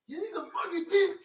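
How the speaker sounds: high, loud mimicked shout; imitated angry yelling. Repeating the mad guy's parting insult, yelling. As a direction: yell it as a mocked angry man. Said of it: "He really started yelling".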